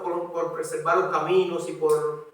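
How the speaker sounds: usual speaking voice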